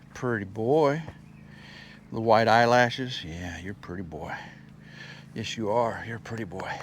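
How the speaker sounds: soft, low loving murmur; cooing pet talk. Real, not acted. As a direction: croon softly to the pretty horse. Cooing at horse.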